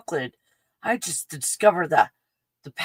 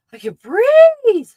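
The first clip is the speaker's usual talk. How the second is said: much higher, louder, slow joyful cry; euphoric cry; 12 dB louder, slower than usual. Real, not acted. Feeling the mint open her lungs, euphoric. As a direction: let the relief burst out big.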